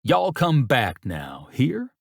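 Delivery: slow Southern drawl lifting high on the last word; mock him with a hammed-up Southern send-off; exaggerated Southern accent. A narrator's reading. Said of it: "In an exaggerated Southern accent".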